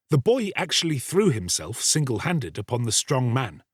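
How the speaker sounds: plain reading voice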